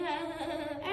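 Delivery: short mimicked giggle; quick demonstration laugh; imitated laugh. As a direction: copy her laughing briefly. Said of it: "Then she started laughing".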